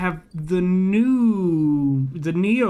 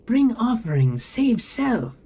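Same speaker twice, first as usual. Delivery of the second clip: high, pointed robotic demand; pointed machine voice; 5 semitones higher, slower than usual. A narrator's reading. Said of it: "Neo Ven says pointedly".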